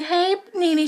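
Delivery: high, sugary fake-nice "Hey, Nene"; mock sweet greeting. Kenya greeting Nene at the door, mock sugary and high. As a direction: sing it up high like a fake-friendly hello.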